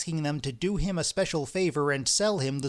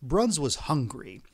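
ordinary delivery, nothing marked

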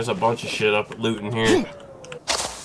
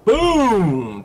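excited boom; 9 semitones higher, 5 dB louder than usual. Real, not acted. Firing a rocket at target.

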